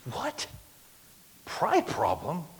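retold affronted surprise; mock incredulity. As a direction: echo the charge in disbelief. Retells his disbelief at pastor's charge.

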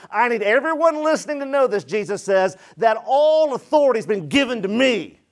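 preacher voicing an emphatic decree; emphatic declaration. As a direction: declare it as Jesus, with authority. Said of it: "he declares this emphatically".

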